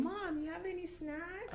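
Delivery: put-on high whiny kid asking; child voice. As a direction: beg mom for snacks like a kid. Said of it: "because I was still saying".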